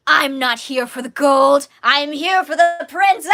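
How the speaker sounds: loud, declaimed pirate villain line; Astrid the Pirate. Acted. Performing a pirate quote for theater fans, loud villainous shout. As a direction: bellow it theatrically as a stage pirate. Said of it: "Any of you theater guys know this quote?".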